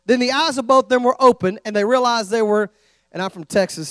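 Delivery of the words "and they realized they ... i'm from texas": normal talking voice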